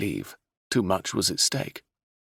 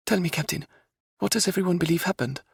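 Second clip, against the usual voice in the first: raised princess voice, pressing and urgent; 12 semitones higher than usual. Acted. Princess insists on passage to Lodore, urgent. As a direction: insist like a determined young royal.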